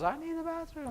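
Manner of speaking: put-on high, small whiny child voice; whiny kid. Kid in the car after the rest stop, high whiny voice. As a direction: play the kid nagging from the back seat. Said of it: "kid goes".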